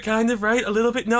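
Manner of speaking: ordinary delivery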